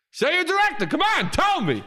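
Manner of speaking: high, insistent demand; hammed-up star egging someone on; Al Pacino. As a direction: push for it, pitched very high. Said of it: "And he goes".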